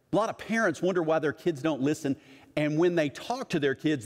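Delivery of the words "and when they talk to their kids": usual speaking voice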